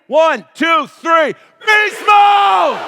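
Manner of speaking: loud, high count then a huge roared chant; rising count into roar. Real, not acted. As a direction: build the count and explode on beast mode.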